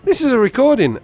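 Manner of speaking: high, loud incredulous cry; amazed realisation. Retold or imitated. Caller realising he's been pranked, loud incredulous realisation. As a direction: exclaim it as the prank fully sinks in.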